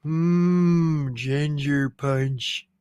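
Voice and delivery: a cartoon dad's lazy, slow, dreamy craving; Homer impression. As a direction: savour it like Homer drooling over food. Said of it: "in my best Homer impression".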